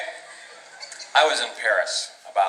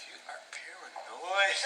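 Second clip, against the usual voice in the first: slow hushed tease; 5 semitones higher, 15 dB quieter, slower than usual. Real, not acted. Teasing the crowd.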